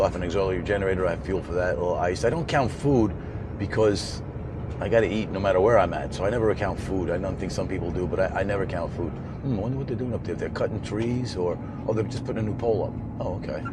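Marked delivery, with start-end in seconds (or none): none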